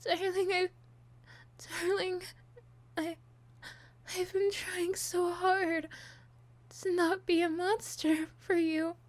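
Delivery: high, loud, tearful breakdown; sobbing, begging, voice breaking high; unhinged villain. Acted.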